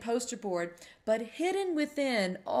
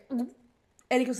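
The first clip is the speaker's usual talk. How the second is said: usual speaking voice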